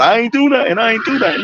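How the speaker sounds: high, whiny mock pleading of a stopped driver; whining mock protest. Mocking drivers who plead with cops, whiny and high. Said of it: "talking about".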